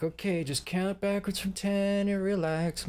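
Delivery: calm him down before the anesthetic, slowly; soothing nurse. Retold or imitated. Nurse putting him under.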